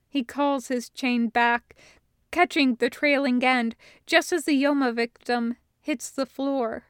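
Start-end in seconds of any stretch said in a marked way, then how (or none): none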